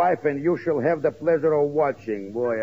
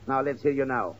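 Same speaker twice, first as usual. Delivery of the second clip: ordinary talk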